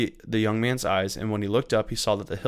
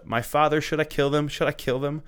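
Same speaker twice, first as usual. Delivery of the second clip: raised-pitch eager question, not a full shout; mildly called out, higher voice; narrated shout; 6 semitones higher than usual. A narrator's reading. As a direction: read a shouted question with restrained volume. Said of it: "he shouted to Elisha".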